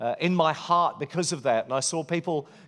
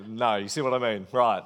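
attempted Welsh accent that slides into an Indian accent, lower voice; botched Welsh accent turning Indian, said lower; failed Welsh accent; 5 semitones lower than usual. Shown as a demonstration. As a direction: try a Welsh accent and let it drift into Indian. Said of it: "whenever I start trying to do the Welsh accent, I always end up in Indian".

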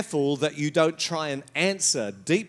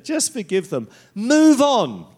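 mock cliche, rising to a loud brush-off; 6 semitones higher, 5 dB louder than usual.

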